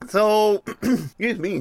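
cracking, rough voice clearing throat. Real, not acted. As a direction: voice breaking after a throat clear. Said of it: "my voice is cracking here".

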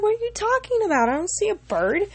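high, puzzled friend's reply; imitated bafflement.

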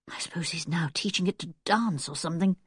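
soft, low wry musing; private thought. A narrator's reading. Anne alone, trying to cheer herself up, quietly wry. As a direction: think it to yourself, trying to smile. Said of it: "trying to make herself smile".

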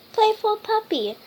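cheerful title call; bright title. A narrator's reading. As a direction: announce the book title with delight. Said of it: "today I'm reading".

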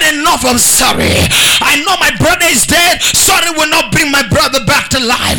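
thundering revival preacher; full-force preaching. Real, not acted. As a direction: drive each line home to the congregation.

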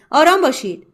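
دستور بلند و بالا با صدای رسا; فریاد آمرانه. A narrator's reading. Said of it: "آمرانه خطاب به افرادش فریاد کشید". (فریادی آمرانه و بلند)

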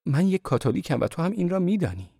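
put-on high, anguished young woman; Marie, distressed. A narrator's reading. Marie crying out.

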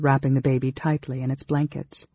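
plain reading voice

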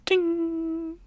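high, soft ringing "ding"; imitated ding. Narrator voicing Zoro's silhouette reveal, imitated ding. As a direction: make a high metallic chime with the voice.

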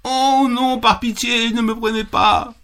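high, small begging voice; pleading little goat. Acted. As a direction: beg for mercy in a tiny voice. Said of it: "dit le bouc".

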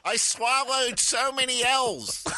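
put-on slow, high Philly accent; Philly accent, L's swallowed. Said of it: "You swallow, swallow your L's".

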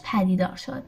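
usual speaking voice

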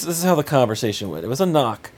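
normal talking voice

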